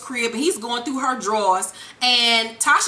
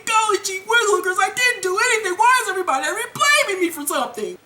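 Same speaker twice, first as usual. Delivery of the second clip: high, rushed goody-goody pleading; mock juvenile protest, high all through; protesting kid nephew; 7 semitones higher, faster than usual. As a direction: play an innocent boy wrongly blamed, pitched way up. Acted nephew pleading innocence.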